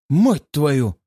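startled outburst of disbelief; shocked exclamation. A narrator's reading. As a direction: blurt it out in shock. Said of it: "вскрикивает грандмастер".

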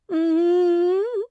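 loud, high drawn-out "mmm"; frightened mooing hum. A narrator's reading. Марсия clinging to the branch, loud wordless hum. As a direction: hum loudly through closed lips. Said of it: "промычала Марсия".

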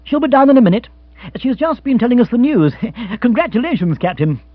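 high, loud, bubbly delight; elated young gentleman; cheerful congratulations. Acted. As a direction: welcome him jovially. Bill cheerfully congratulates the captain.

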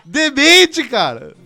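high, loud, amused outrage; loud indignant disbelief. Real, not acted. Host berating a listener over a disgusting question, loud disbelief.

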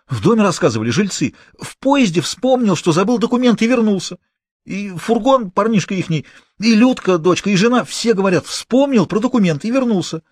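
a scared small-time crook blurting it out; a cornered accomplice answering the gang boss, high and nervous; frightened petty thief. A narrator's reading.

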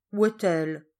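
astonished question, pushed out loud; surprised. A narrator's reading. Sister finds the servant missing, surprised. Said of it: "s'étonna mademoiselle Mathilde".

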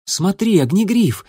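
high calling shout. A narrator's reading. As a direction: call him to look. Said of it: "окликнула песчаная буря".

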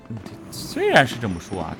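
normal talking voice

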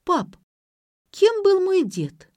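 high, loud, slow question; sudden question. A narrator's reading. Daughter suddenly asks father about grandfather, high and pointed. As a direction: call to dad, then ask pointedly on a high pitch. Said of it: "неожиданно спросила Ксения".

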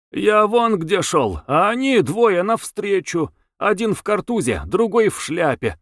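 high-voiced, lively man from the crowd; animated street witness in a high voice; brisk eyewitness. A narrator's reading. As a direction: tell it eagerly, pitched well up. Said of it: "бойкий, торопливый рассказ человека с креслом".

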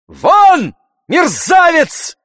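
very high, loud, slow shout of rage; furious shout. A narrator's reading. Oblomov throwing Tarantyev out, shouting in rage. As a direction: scream it, trembling with fury. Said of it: "закричал Обломов".

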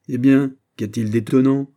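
plain reading voice

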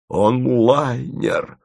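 очень медленно, нараспев с причитанием; запричитай от восторга; причитая. A narrator's reading. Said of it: "запричитал Подмухин". (причитает в изумлении, медленно)

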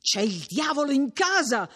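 high-pitched, mildly breathless alarm; panting alarm. A narrator's reading. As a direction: blurt it out as if winded from running. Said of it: "riprese Menico ansante".

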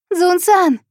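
high, loud call; shouting. A narrator's reading. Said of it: "кричала она".

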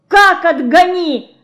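high, slow, loud outraged cry; shouting. A narrator's reading. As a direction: shout it out in disbelief. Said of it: "закричала Динка". (громкий возмущённый крик)

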